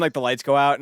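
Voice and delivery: ordinary talk, nothing marked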